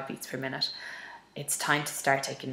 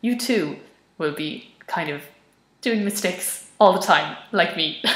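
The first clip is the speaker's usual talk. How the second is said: louder, jokey and self-mocking; playful loud rephrase; 3 semitones higher, 9 dB louder than usual. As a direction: bellow it like a cheerful confession. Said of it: "that sounds a bit poncy".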